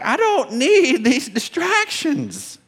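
a dad thinking, fed up with the carnival; annoyed dad. Retold or imitated. Dad to himself in the busy store, slow and exasperated.

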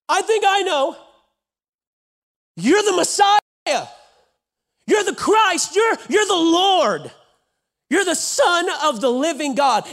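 high, loud and slow proclaiming; excited loud declaration. Acted. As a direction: Peter bursting out with certainty. Peter blurts out his answer.

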